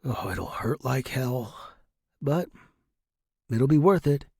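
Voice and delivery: ordinary delivery, nothing marked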